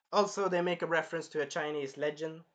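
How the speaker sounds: usual speaking voice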